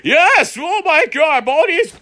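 high-pitched excited exclaiming; elated. Real, not acted. Player triumphs over Baldi, elated.

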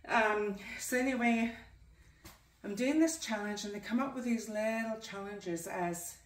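breathless from exercising; out of breath. Real, not acted. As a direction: talk while panting from a workout. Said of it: "sorry, I'm really out of breath".

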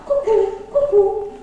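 loud, slow cuckoo call. Acted. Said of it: "Zakukała".

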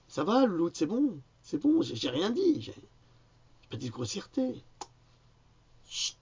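high sing-song coaxing voice; high playful excuses to Louloute; pet talk. Real, not acted. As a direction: soothe her like a fussing pet. Talking to Louloute mid-rant.

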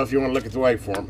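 ordinary talk

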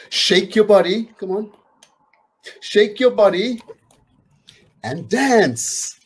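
high, bright cheer; high, lively call to shake and dance; excited call to dance. Acted. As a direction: get the kids up and moving. Calls children to dance.

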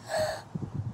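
sudden unvoiced gasp of alarm; sharp gasp. A narrator's reading. Reading to a kid, gasping at the hidden salsa. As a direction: gasp as you spot the danger.

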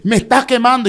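high, loud cry that it burns; retold scream. A man on the floor screaming at the prophet's burning hand. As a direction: yell that it is burning. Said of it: "y yo estoy gritando".

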